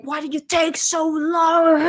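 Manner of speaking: strained, held-back mock shout; retold yell. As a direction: imitate him yelling at her. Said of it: "yelled at her".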